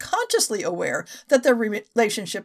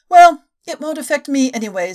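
blithely; 3 semitones higher, 9 dB louder than usual. Acted. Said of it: "said Miss King blithely".

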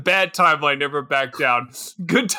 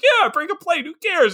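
high-pitched mock-manic mother; reckless mom; 8 semitones higher than usual. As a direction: imitate a mom gone carefree, voice pitched up. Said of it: "the mom's like".

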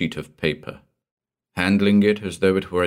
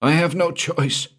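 much higher, anxious explaining; frightened Walstead; 12 semitones higher than usual. Acted. Frightened man explaining his ruin, anxious and high. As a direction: plead your case in distress.

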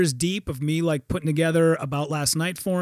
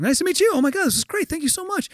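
high, gushing imitation; mock breathless-with-awe thanks; starstruck fan; 11 semitones higher, faster than usual. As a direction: gush at your idol. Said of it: "And he's like".

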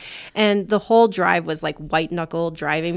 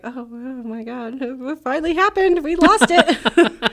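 giddy disbelief; 6 semitones higher, 3 dB louder, slower than usual. Retold or imitated. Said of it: "I was just like".

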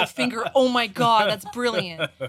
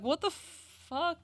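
high, slow, dumbfounded retelling; imitated shock, words dragged out in bewilderment; acted stunned disbelief; 6 semitones higher, much slower than usual. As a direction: stretch it out like a baffled onlooker. Retelling everyone's stunned reaction.

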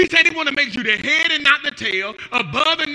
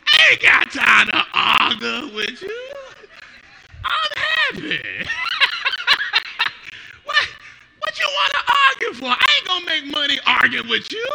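[name] impression; 2 semitones higher than usual. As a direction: drag it out then jump into a squeal. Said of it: "made me think about [name]".

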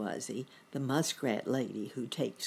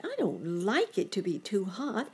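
lightly voiced young bunny, pitched up; higher voice with a touch of complaint; mild dismayed cry; 6 semitones higher than usual. A narrator's reading. Boy bunny complaining of the heat, mildly dismayed. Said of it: "cried Sammy".

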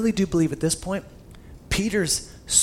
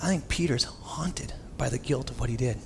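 soft, near-whispered aside; hushed reflection; 3 semitones lower, 6 dB quieter than usual. Real, not acted. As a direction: lean in and breathe it quietly.

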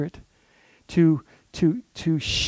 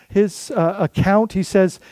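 ordinary delivery

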